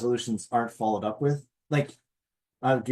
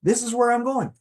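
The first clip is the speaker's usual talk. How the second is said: much higher, wide-eyed wow; amazed at progress; 11 semitones higher than usual. Retold or imitated. Looking back on your progress, amazed and higher. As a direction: marvel at how far you've come. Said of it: "you go".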